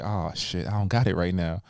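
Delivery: soft, sheepish mock panic; nervous mumble. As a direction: caught unprepared, muttering an excuse. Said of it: "perform this like".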